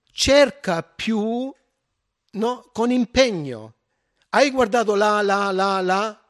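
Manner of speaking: hammed-up nag, pointing everywhere; nagging wife. Wife prodding her husband to look harder, mock nagging.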